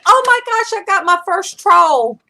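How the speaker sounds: high, loud squeal of delight; hammed-up retold thrill; over-the-top excitement. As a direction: gush as if you just won a prize. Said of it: "I was so over the top".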